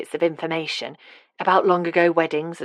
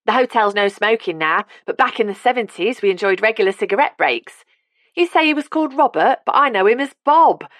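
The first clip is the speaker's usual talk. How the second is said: put-on lively elderly Londoner, higher and louder; Cockney old woman; 7 semitones higher, 5 dB louder than usual. A narrator's reading. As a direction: voice a cheerful old Cockney lady. Carmel voiced in the story.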